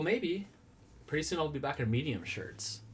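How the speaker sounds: ordinary delivery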